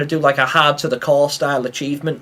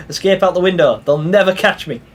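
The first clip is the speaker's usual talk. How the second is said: ordinary delivery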